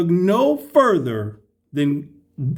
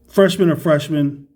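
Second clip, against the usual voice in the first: ordinary delivery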